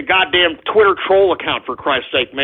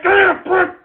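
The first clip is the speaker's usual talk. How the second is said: much higher, pleading and fed up; exasperated cry; 9 semitones higher than usual. Acted. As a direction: push it up high, at the end of your rope. Escalating repeated exasperation.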